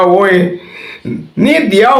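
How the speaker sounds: laughing reply. Retold or imitated. Host retelling his joke answer to a scammer, laughing. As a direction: squeal it out between laughs.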